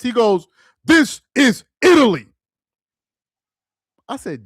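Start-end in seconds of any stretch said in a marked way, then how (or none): 0.8-2.3 loud, high, indignant "This is Italy"; angry Italian friend. Retold or imitated. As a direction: bark it like a furious proud Italian. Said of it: "That's how he said it".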